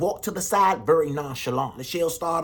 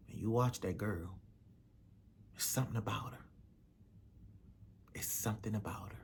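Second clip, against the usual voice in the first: low warning; 7 semitones lower, 13 dB quieter than usual. Acted. Mother warns about girl.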